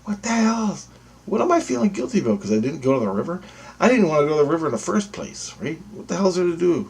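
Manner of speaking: exasperated self-argument. Retold or imitated. Arguing with himself over guilt.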